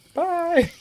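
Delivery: very high-pitched little character voice; McGreedy. Acted. Streamer voicing a character's sign-off, high squeaky voice. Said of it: "McGreedy says bye".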